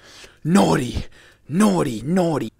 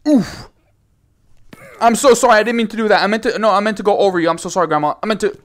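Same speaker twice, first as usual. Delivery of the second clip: higher, louder rushed sorry; frantic apology; 5 semitones higher, 5 dB louder, faster than usual. Real, not acted. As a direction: apologize in a flustered rush. Mock apology after flying.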